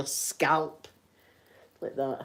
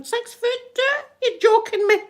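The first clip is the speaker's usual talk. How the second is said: much higher, a shocked squeal at "Six foot two?"; surprised disbelief; 5 dB louder than usual. Real, not acted. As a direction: gasp it out in disbelief at the height. Told the queen's real height.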